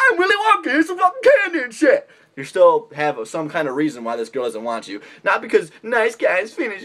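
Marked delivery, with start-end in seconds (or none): 0.1-2.1 much higher, hammed-up candy man with a laugh; mock Willy Wonka voice. Nice guy mocked as Willy Wonka, giddy high squeal. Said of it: "You'd be like".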